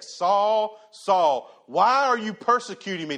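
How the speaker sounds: a lightly voiced heavenly call; calling voice of Jesus.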